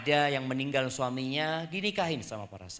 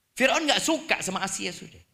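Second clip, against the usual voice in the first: normal talking voice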